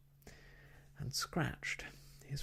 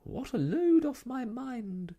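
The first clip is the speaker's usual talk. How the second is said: sighing with relief; 14 semitones higher, 6 dB louder than usual. Acted. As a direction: let the relief pour out on a high sigh. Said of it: "sighing loudly".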